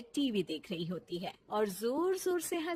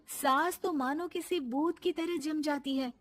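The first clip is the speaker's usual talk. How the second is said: ordinary talk, nothing marked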